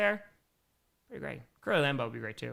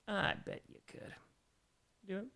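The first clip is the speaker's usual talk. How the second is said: hushed aside; 4 semitones higher, 10 dB quieter than usual. Real, not acted. Streamer answering a viewer's football-throwing boast, hushed aside. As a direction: say it under your breath, stretched out.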